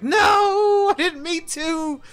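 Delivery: high, drawn-out cry of dismay, loud on "No"; mock anguished wail. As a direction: wail it in hammed-up regret. Re-enacts dismay at code redeemed.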